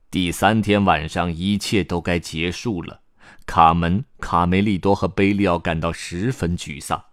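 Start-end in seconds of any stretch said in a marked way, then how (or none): none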